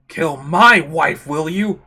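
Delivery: loud, slow accusation; vengeful anger. Acted. Confronting the wife's killer, slow loud fury. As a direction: press out each word loudly and slowly.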